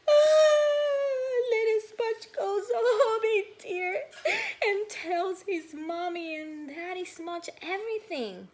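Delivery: high loud weepy narration; crying. Acted. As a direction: tell it through sobs.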